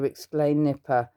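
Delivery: usual speaking voice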